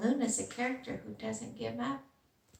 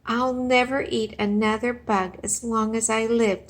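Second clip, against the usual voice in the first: cheered; 8 dB louder than usual. Acted. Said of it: "cheered Stella Luna".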